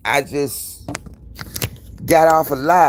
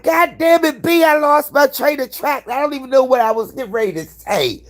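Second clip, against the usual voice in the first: much higher, exasperated mock complaint; flustered high retelling; 13 semitones higher than usual. Telling friend B she lost her train of thought, high and flustered. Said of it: "I'll be like".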